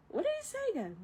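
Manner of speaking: high, soft puzzled little dragon; squeaky Mushu voice. Retold or imitated. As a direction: squeak it out, confused.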